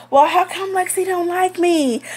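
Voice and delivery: high, whiny question; whiny mock man. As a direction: whine like a sulking guy. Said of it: "he gonna ask".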